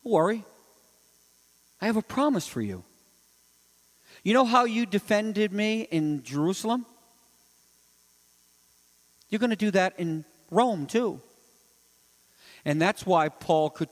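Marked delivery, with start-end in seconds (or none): 0.0-11.2 gentle reassurance. Acted. Voices Jesus reassuring Paul.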